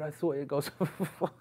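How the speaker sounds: words dissolving into real laughter; breaking into laughter. Real, not acted. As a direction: start the quote, then crack up. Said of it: "She really made me laugh".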